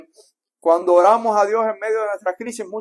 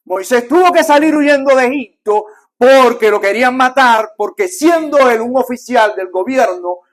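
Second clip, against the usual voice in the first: loud, driving sermon delivery; preaching at full force; 4 semitones higher, 8 dB louder than usual. Acted. Preacher telling the congregation about Moses, loud full-force preaching. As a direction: thunder it from the pulpit.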